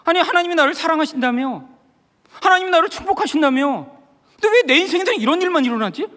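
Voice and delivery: high-pitched indignant protest to God; retold anger. Husband angry at God during wife's hymn, acted indignation. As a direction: throw it out accusingly, pitch up high. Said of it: "너무너무 화가 나기 시작했어요".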